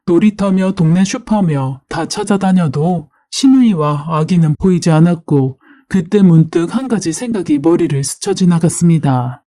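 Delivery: ordinary delivery, nothing marked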